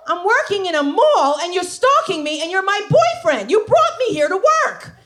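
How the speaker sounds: loud, high indignant reply; exasperated retort. Retold or imitated. Girlfriend snapping back at a stalking boyfriend, loud and high. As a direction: throw it back at him. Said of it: "her reply to stalker boyfriend".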